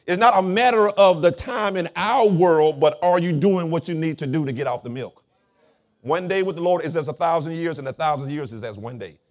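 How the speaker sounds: loud, emphatic sermon delivery; forceful preaching. Real, not acted. Pastor explaining spiritual maturity to the church, forceful preaching. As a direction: drive the point home to the congregation.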